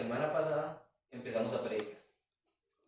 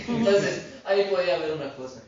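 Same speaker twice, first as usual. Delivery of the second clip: laughing; 5 semitones higher, 9 dB louder than usual. Real, not acted. Laughs after pastor's answer.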